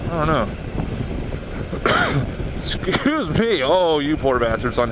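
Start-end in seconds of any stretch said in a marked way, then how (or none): 0.5-2.5 a harsh, breathy cough; real cough. Real, not acted. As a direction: cough into the microphone. Coughs, then excuse me.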